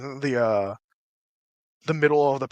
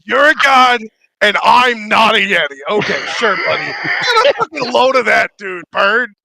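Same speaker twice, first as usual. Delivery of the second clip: higher, louder mocking rant; ranting character; 4 semitones higher, 7 dB louder than usual. The voice mocks the owl player, loud ranting. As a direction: needle the bird person. NPC rants at bird person.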